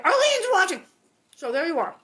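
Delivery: thrilled she's watching; excited shout. Real, not acted. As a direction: shout her name in delight. Excited calling out.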